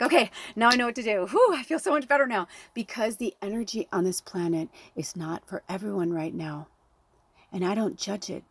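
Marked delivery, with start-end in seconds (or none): 0.0-2.5 loud, higher burst of relief; mock relieved. Departed soul newly back in spirit form, loudly relieved. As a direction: blow out and exclaim like a load is lifted.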